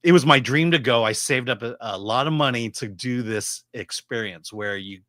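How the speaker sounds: ordinary delivery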